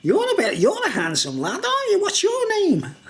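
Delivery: high, sing-song fawning voice of a weird bloke; high fawning mock voice; mimicking creepy guy. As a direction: gush at the lad in a high put-on voice. Imitating the creepy guy's voice.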